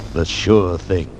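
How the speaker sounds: normal talking voice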